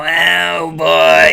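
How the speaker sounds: loud, drawn-out sandpaper voice; Roz. Retold or imitated. Podcast host imitating the actor's scratchy voice work, loud rasp. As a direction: push out a rough, strained character groan, stretched long. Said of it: "the voice sounds like you're running your vocal cords over sandpaper".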